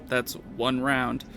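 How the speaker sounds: usual speaking voice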